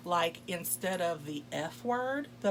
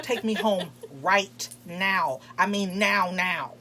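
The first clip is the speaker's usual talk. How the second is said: slow, louder, firm demand; 6 dB louder, slower than usual. Retold or imitated. Demanding the date take her home, slow and loud. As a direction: lay down the law slowly. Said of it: "I'm like".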